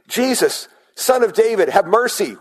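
loud, high plea for mercy; crying out. Acted. Blind men calling to passing Jesus, high loud plea. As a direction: shout it across the crowd to Jesus.

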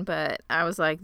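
ordinary delivery

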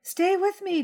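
put-on high childish echo without crying; little boy, no tears. Little brother repeats the request to mother, high but tearless. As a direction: copy your brother but dry-eyed. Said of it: "minus the tears".

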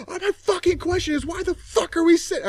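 high, loud mock angry shriek; screaming at her. Snapping at the nurse in the waiting room, a high loud mock scream. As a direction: scream the question back in a high voice. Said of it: "screaming at her".

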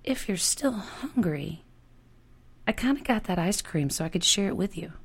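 slow, hushed, careful offer; shy girl easing out a nervous offer. Acted. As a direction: feel out each word slowly, half whispered. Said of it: "the words coming out slowly and carefully".

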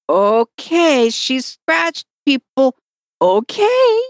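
drawn-out, doubtful okay, rising high; stretched-out mock wariness; imitated wary okay. Retelling the doubtful volunteer's reaction.

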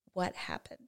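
put-on quiet, gentle "What happened?" to a child; soft calm question. Mother gently asking her daughter what happened, quiet and calm. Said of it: "I'm going to lower my voice".

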